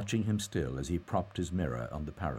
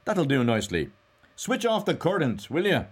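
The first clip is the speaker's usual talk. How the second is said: loud, high, breezy order; brisk cry; 10 semitones higher, 8 dB louder than usual. A narrator's reading. Mulligan calls to switch off the current, brisk cry. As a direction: call out the request briskly. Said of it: "he cried briskly".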